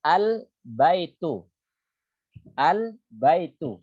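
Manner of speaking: Al-baitu said slowly, lam held closed as sukun before the ba; al-baitu with silent-style lam. Shown as a demonstration. Teacher demonstrating al-qamariyah to students, slow careful al-baitu. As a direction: drawl out Al-baitu syllable by syllable, lam clearly sukun. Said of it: "dibaca sukun".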